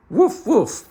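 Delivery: high loud woof woof like a big friendly dog; dog barking. Acted. Narrator voicing the dog to Meddle, a loud high bark. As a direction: bark it out brightly. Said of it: "said the dog again".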